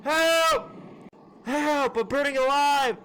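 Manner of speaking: high-pitched panicked cries for help; hammed-up panic, like you're on fire; burning scream. Skit intro, model on fire.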